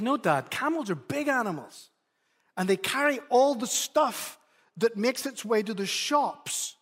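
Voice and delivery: lightly voiced son lecturing his dad; earnest child voice. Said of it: "And he goes".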